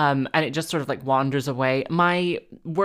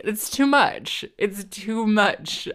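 slow, higher, dramatic "too much"; overwhelmed; 6 semitones higher, slower than usual. Real, not acted. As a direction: drag it out, overwhelmed. Dramatic dread of killing rat.

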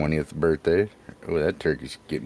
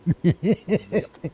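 squealing high laugh; high-pitched squealing laugh; high squeal of laughter. Real, not acted. Cracking up at the spatula.

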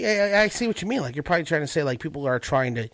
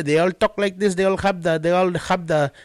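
higher-pitched Filipino accent; 6 semitones higher, 4 dB louder than usual. Shown as a demonstration. Comic demonstrating the accent from the act, Filipino accent. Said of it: "I do a Filipino accent".

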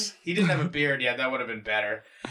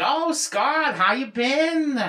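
put-on sweet hello, drawn out and higher; mock friendly greeting; 7 semitones higher, much slower than usual. Woman who secretly hates Scott greets him, mock friendly. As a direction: greet with exaggerated, stretched-out friendliness. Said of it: "she talks to me like that too".

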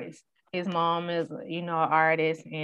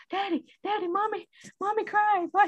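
put-on toddler calling daddy; 14 semitones higher than usual. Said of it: "she would be like".

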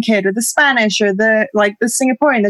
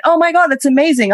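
higher-pitched imitation of an excited girl; impressed gushing; 6 semitones higher than usual. New acquaintance hearing where she is from, excited. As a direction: lightly mimic someone thrilled. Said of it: "And she went".

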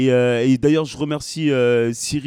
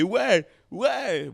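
crowd cheer; 5 semitones higher than usual. Shown as a demonstration. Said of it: "dire des".

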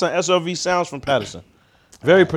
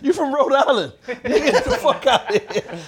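laughing; 8 semitones higher than usual. Real, not acted. Laughing mock accusation.

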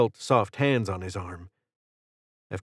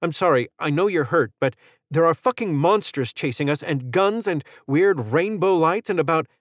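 higher, rising exasperated rant; frustrated outburst; 7 semitones higher than usual. Acted. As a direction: let the panic boil over into anger. Frustrated outburst at wounded Matt.

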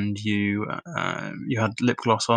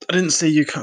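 hiccuping; 6 semitones higher, 4 dB louder than usual. Real, not acted. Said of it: "sorry, I'm hiccuping a little bit".

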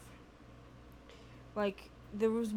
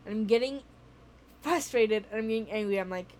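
ordinary delivery

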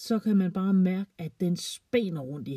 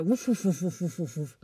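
mock barking. A narrator's reading. Narrator imitates barking little dog.

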